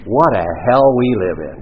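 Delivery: loud, sing-song commiserating line; hammed-up sympathy; mock sympathy. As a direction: commiserate with a big show. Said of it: "But I understand".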